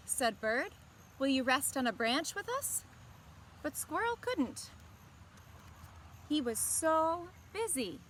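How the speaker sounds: gentle, expressive storybook reading; picture-book narration to young listeners; reading to children. A narrator's reading. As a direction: read it like story time for kids. Story narration and character line.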